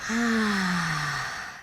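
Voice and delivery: quiet calming "ah" sigh; soft "ah" sigh. Shown as a demonstration. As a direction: sigh softly so a friend breathes with you. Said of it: "just do this right next to them".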